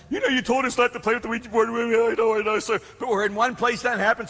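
high, rushed mock-tearful voice; hammed-up tearful explaining; imitated sobbing. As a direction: babble like an upset boy, pitched high. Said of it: "both of these guys are sobbing".

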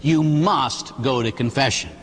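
normal talking voice

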